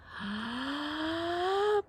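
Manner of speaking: a big audible in-breath through the mouth. Shown as a demonstration. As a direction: breathe in deeply to show the kids. Deep breathing exercise with kids.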